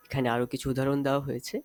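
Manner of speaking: usual speaking voice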